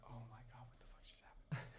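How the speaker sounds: whispered, no voice at all. Retold or imitated. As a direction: hush it like a secret shock. Shocked thought under his breath.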